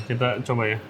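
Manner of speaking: normal talking voice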